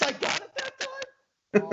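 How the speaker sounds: squeaky high, slow disbelief; can't believe he guessed right, squeaking high and slow; surprised. Real, not acted. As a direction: stretch it out in a shocked falsetto.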